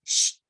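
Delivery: a long hushing sh; sh sound. Shown as a demonstration. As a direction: hold the sh like shushing. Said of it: "que el sonido es".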